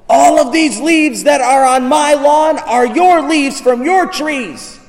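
high, drawn-out neighbor's rant; mock yelling. Neighbor complaining about leaves on his lawn, yelling. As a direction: yell each word slowly in accusation. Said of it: "he starts yelling".

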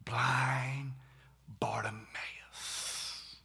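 hushed, loving whisper; soft whispered name. Shown as a demonstration. Preacher savoring a beloved name, whispered. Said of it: "Say, say that with me".

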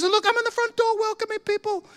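very high, thrilled imitation; excited boasting. Convert showing the preacher his role, very excited. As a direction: imitate a man giddy with his news. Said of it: "he's so excited".

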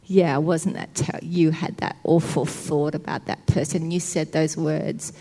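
low, insinuating accuser; condemning enemy. Acted. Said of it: "he'll try and condemn you".

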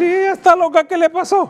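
high, loud mock baffled voice with laughter; imitating puzzled dad. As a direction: squeal it like a shocked onlooker. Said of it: "Y él". (voz aguda burlona de asombro)

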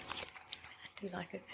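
soft unvoiced aside; whispering. Real, not acted. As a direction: whisper it as a private confession. Aside about the pretty cover.

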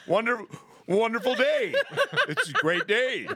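high, bright fake-sober cheer; overly sober act. Drunk guy faking sober at the TSA, high mock cheer. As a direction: overdo sounding sober for the TSA. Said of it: "trying to be too sober".

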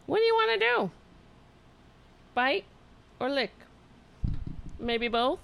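high squeaky pet talk; squeaky praising a dog. Real, not acted. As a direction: coo at the cat, high and sweet. Asking the cat playfully.

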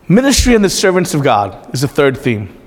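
usual speaking voice

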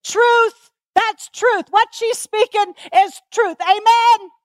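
congregation calling back. Retold or imitated. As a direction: shout back your agreement to the preacher. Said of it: "do you know what they're saying to me".

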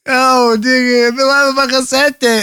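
ordinary talk

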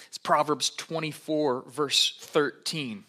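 "Proverbs twenty-four, verse thirteen" said slowly and clearly. Real, not acted. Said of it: "announcing reference slowly".

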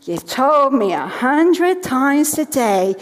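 slow, drawn-out mock exasperation; lightly voiced daughter who has heard it all before; imitated child. Mother imitating daughter tired of hearing "I love you", mock exasperation. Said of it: "And she'll say to me".

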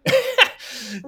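high burst of laughter. Real, not acted. Laughing at the memory.

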